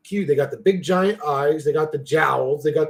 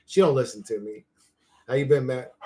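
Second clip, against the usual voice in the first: ordinary delivery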